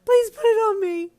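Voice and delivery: high, loud, drawn-out happy wail. A narrator's reading. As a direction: wail it through tears of joy. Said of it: "you wailed".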